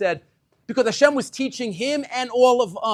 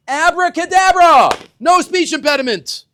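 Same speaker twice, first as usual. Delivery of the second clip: much higher and louder, a grand flourish; hammed-up magic announcement; 9 semitones higher, 9 dB louder than usual. God's imagined magic fix.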